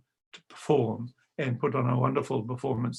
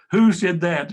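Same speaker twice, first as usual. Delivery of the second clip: loud, raised who said that; indignant demand; 4 semitones higher, 7 dB louder than usual. Retold or imitated. Wizard turning on the crowd, loud and indignant. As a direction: challenge the crowd sternly.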